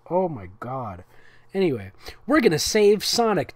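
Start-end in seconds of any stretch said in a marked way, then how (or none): 2.3-3.5 confident. Acted. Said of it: "Tails confidently replied".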